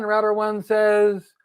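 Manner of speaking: ordinary talk, nothing marked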